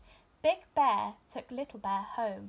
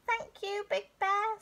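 high small voice of a scared cub; high-pitched timid bear cub; little bear cub; 11 semitones higher than usual. A narrator's reading.

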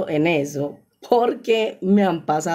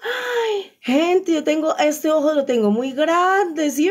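laughing squeal; 11 semitones higher, slower than usual. Real, not acted. Laughing at her own face.

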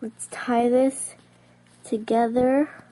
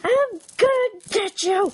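much higher play-villain voice; mock threat; 9 semitones higher than usual. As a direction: threaten playfully, pitched way up. Acted chase threat.

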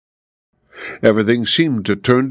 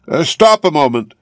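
a higher call to stop him; a lightly voiced old woman calling him back; 6 semitones higher, 3 dB louder than usual. A narrator's reading. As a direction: call after him, mildly raised. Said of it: "cried the old woman again".

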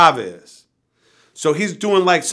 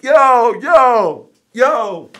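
high, loud repeated shout; calling out; 6 semitones higher, 5 dB louder, much slower than usual. Retold or imitated. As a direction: holler to get someone's attention from afar. Calling out to departing promoter.

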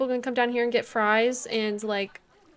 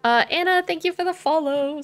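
excited; 6 semitones higher, 5 dB louder than usual. Real, not acted. Excited thanks to follower.

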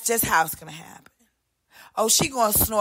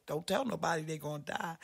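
fast, much softer secret warning; hushed warning; 7 semitones lower, 17 dB quieter, faster than usual. Acted. Warning a friend not to tell anyone. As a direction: keep it low and quick like a secret.

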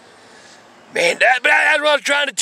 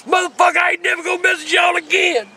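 higher, hammed-up shout of surrender; mock shouted promise; 7 semitones higher than usual. Teller promises never to mess with them, mock shouting. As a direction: yell it like swearing off trouble.

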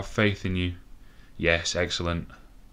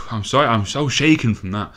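ordinary delivery

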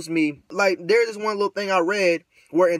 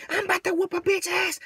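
very high-pitched imitation of a brawler; mock ratchet woman; 13 semitones higher than usual. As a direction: squeal a mock threat in falsetto. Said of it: "All that ratchet shit".

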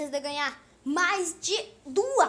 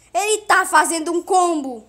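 very loud, higher-pitched yell; alarmed shout; 5 semitones higher, 12 dB louder than usual. Real, not acted. Gamer spots the rival's combo, alarmed loud shout. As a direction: shout every word at full volume.

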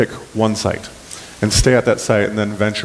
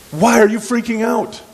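very high, exasperated question; incredulous paraphrase; 11 semitones higher than usual. Acted. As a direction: ask it in high-pitched mock disbelief. Casual paraphrase of Jesus's question.

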